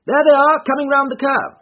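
high, loud excited call; excited shout. A narrator's reading. Holmes calls out the race.

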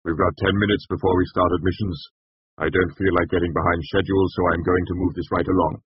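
businesslike, firm chancellor's voice; brisk. A narrator's reading. Chancellor keeping a hearing on schedule, brisk. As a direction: move the meeting along. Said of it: "the Chancellor said briskly".

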